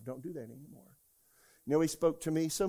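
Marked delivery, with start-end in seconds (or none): 0.0-0.9 far softer, lower quiet warning; soft rebuke. Retold or imitated. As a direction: murmur it as an inner correction. Repeating the Spirit's rebuke.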